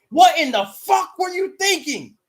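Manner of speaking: high, loud furious yell; hammed-up locker room tirade; chewing him out. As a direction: ream him out like an angry teammate. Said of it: "I would chew him out, saying".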